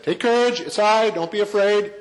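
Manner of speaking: a hint of Jesus calming frightened men; Jesus reassuring. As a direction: call out comfort over the water. Jesus calms disciples on water.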